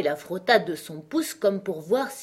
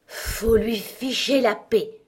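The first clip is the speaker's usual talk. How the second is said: slow, higher frog with effort; panting old frog; 4 semitones higher, 4 dB louder, slower than usual. A narrator's reading. Frog scolds after kicking the door, slow and effortful. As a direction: push it out slowly, as a puffed frog. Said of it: "dit-elle tout haletante".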